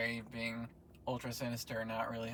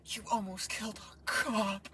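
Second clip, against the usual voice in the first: high, slow mock outrage; shrill-free high put-on cop-defender voice, drawn out; mock-indignant high voice; mocking quote; 10 semitones higher, slower than usual. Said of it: "Jean DeWolf was like".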